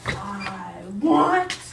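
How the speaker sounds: low eerie moan, only a hint of a voice; the basement ghost moaning; lightly voiced ghost. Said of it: "it sounded like this".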